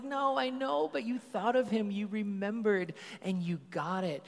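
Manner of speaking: high, earnest enthusiastic praise; eager praise. Retold or imitated. Dad insisting to his daughter, high and excited. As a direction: insist warmly on what she did. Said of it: "I'm like".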